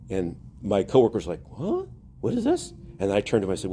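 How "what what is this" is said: baffled surprise. Retold or imitated. Coworker reacting to a customer's unexpected apology, baffled. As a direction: imitate a bewildered coworker, pitched way up.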